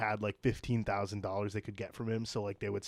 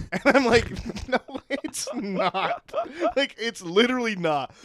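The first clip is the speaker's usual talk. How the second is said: laughing disbelief; 11 semitones higher, 8 dB louder than usual. Real, not acted. Scam-call target scoffing at a caller named John, laughing. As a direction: laugh helplessly while objecting, loud and high. Laughs at scammer's claimed name.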